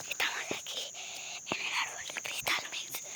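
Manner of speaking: soft secretive whisper. Acted. Whispering tour to viewers.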